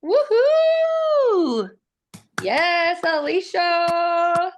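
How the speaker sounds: high whooping cheer for the winner; hyped giveaway announcement. Real, not acted.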